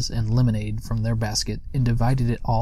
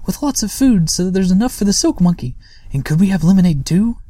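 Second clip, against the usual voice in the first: high, louder begging in a small creature voice; pleading; 8 semitones higher, 6 dB louder than usual. Acted. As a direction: keep wheedling for treats. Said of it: "entreated Sniff".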